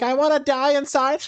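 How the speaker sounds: teasing high-pitched mock despair. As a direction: squeal it as a hammed-up inner wail. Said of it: "You're like".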